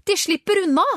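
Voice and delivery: high, loud cry of alarm; shouted warning, high and forceful; urgent shout. A narrator's reading. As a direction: call it out loudly, pitch jumping high. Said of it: "ropte Richard".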